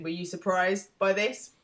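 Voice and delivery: usual speaking voice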